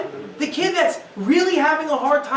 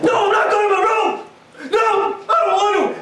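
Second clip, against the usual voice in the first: put-on loud, high refusal from an angry kid; defiant child; 6 semitones higher, 5 dB louder than usual. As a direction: shout it back like a kid who won't budge. Defiant child refusing his room.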